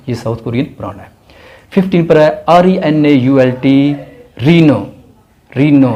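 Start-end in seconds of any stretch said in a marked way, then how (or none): 4.4-5.9 Reno said ri-no, no final t; Reno, silent l and t; brand name pronounced. Shown as a demonstration. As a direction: say Renault as re-no. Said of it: "Renault नहीं पढ़ना".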